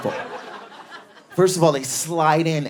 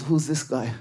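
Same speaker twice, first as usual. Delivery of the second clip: put-on old man; 5 semitones lower, 6 dB quieter than usual. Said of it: "He goes".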